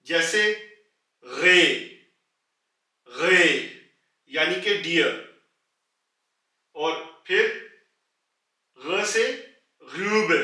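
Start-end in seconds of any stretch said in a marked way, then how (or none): 1.2-1.6 गे said loudly with a hard ग. Shown as a demonstration. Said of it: "ग की होती है". 3.1-3.5 गे said loudly with a hard ग. Shown as a demonstration. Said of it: "ग की होती है". 9.8-10.3 ग्यूब with a rounded, flattened ऊ; umlaut form. Shown as a demonstration. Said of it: "आवाज थोड़ी सी फ्लैट हो जाएगी".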